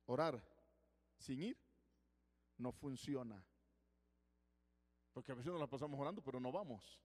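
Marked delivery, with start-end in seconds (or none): 0.0-3.4 Orar, sin ir, no funciona set apart by pauses; spaced-out maxim. Shown as a demonstration. Preacher stating his philosophy, slow and paced. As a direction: space each piece out. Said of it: "Yo tengo una filosofía".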